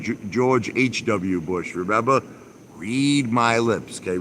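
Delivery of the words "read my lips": slow emphatic. Retold or imitated. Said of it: "Remember George, uh, George Bush?".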